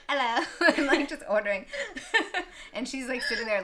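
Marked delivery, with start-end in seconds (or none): 0.1-0.4 higher, louder mock-Aussie greeting; put-on Australian accent. Woman retells ordering at family dinner, fake Australian accent. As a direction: call it out in a bad Australian accent. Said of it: "Outback Steakhouse".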